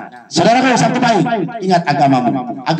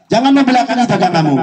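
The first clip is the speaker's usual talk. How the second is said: first take pitched up high, urgent; high emphatic warning; 9 semitones higher than usual. Real, not acted. Repeats the warning twice. (peringatan bernada tinggi)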